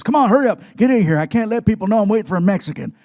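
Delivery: loud gruff wrestler teasing; Stone Cold voice. Retold or imitated. Wrestler hurrying the comedian inside, loud gruff teasing. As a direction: bark it like a tough guy joking around.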